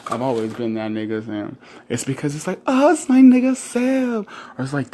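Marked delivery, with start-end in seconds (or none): 2.7-4.2 high, slow drawn-out friendly call; mock a buddy hailing him; imitating a friend. Friend greeting Sam by his nickname, high drawn-out call.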